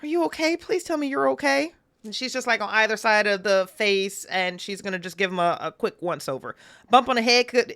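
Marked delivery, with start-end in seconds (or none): 0.0-1.7 worried. Acted. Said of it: "She's like".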